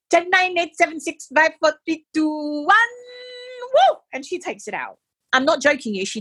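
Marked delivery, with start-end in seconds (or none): 0.1-4.0 counting nurse. Retold or imitated. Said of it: "she counts backwards from ten".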